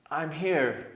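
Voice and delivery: ordinary delivery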